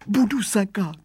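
high, whimpering promise; whining voice. A narrator's reading. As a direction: whine it in a small pleading voice. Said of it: "sliboval výrostek kňučivým hlasem".

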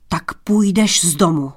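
angry king, shout held back. A narrator's reading. King throwing Tomáš out, understated angry shout. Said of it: "křikl zlostně král".

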